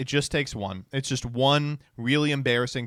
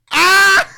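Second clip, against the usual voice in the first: loud high shocked yelp; pained yelp; 16 dB louder than usual. Real, not acted. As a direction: yelp at bad news. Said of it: "I deserve this".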